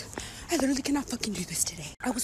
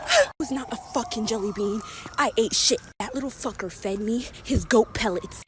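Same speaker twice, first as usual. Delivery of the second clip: usual speaking voice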